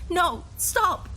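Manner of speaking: high, loud pleading cry; father fighting the officer pulling him back; desperate plea. Acted. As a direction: beg them to stop. Dragged away from daughter.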